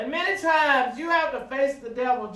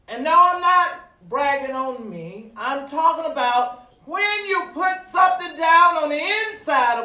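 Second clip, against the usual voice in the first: loud, higher sermon delivery; raised, emphatic preacher's voice; forceful preaching; 6 semitones higher, 9 dB louder than usual. Real, not acted. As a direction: press the point home from the pulpit.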